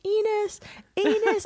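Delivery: playful imitation of a far-off shout. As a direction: call it out high like someone yelling from the waves. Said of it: "she is shouting penis to the shore".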